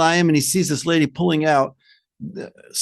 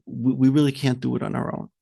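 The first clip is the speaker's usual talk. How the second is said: soft, halting, low-voiced giving up; hesitant mock admission; 6 semitones lower, 4 dB quieter than usual. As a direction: falter quietly as if defeated. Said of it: "the Meraglim said".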